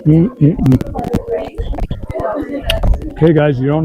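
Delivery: talking while chewing food; mouth full. Real, not acted. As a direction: speak around a mouthful of food. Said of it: "Talking with my mouth full".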